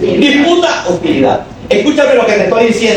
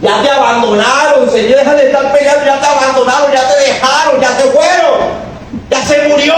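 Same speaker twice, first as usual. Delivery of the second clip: higher, rising preacher's build; preaching build; 7 semitones higher than usual. Acted. Preacher urging the flock to move on, higher and rising. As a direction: stir the church up, line by line.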